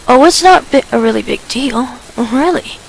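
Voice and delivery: plain reading voice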